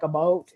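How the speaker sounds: "about" said Canadian-style as "a boat"; Canadian pronunciation. Retold or imitated. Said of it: "the way they say about".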